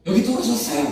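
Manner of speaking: high, loud call for service; complaining call-out. Acted. Buffet guest calling to staff that food ran out, complaining call-out. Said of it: "소리치는 사람들".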